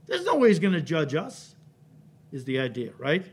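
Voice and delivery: normal talking voice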